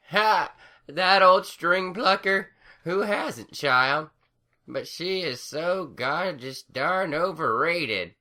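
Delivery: put-on high, slow old crone voice scoffing; cackly old granny scoffing. A narrator's reading. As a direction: dismiss it like a cranky grandma. Granny Smith figure voice.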